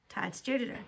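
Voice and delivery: plain reading voice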